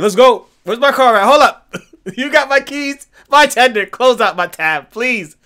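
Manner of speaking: loud mock eager bar exit; eager rush. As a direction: blurt it out like you can't leave fast enough. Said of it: "I was ready to be like".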